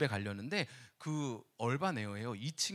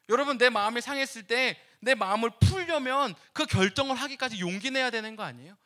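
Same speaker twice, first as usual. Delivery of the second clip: ordinary talk, nothing marked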